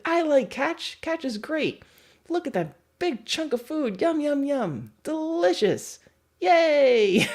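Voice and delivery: high, gleeful put-on dog voice; a delighted dog gobbling treats; happy dog voice. Dog to the trainer during a game of catch, high gleeful voice. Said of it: "And the dog is like".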